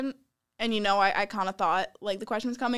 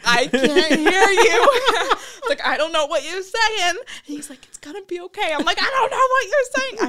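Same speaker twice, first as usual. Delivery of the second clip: high, loud, laughing half-shout; frantic laughing yell; 9 semitones higher, 7 dB louder than usual. Retold or imitated. Retelling how she yelled at her date she couldn't hear, laughing and high. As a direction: yell through laughter that you can't hear him. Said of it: "I'm just getting angry".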